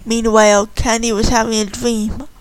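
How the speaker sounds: usual speaking voice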